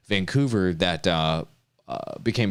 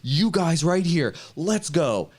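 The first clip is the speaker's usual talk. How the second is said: imitating hype; 7 semitones higher, much slower than usual. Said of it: "He's like".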